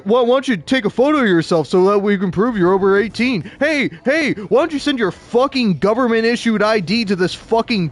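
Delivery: high, loud, pushy sales-pitch voice; mock website voice. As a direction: mimic a nagging porn site begging for ID. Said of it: "half the damn websites I go to are like".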